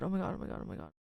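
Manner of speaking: breathless frightened whisper; hushed oh my god, fading to nothing; scared whisper. Real, not acted. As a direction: whisper it, genuinely spooked. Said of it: "Oh my god, I'm scared".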